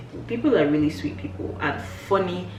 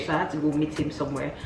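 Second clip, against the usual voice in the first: ordinary delivery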